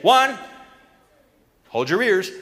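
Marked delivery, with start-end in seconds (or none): none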